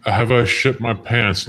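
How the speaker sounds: ordinary talk, nothing marked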